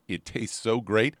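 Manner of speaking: ordinary delivery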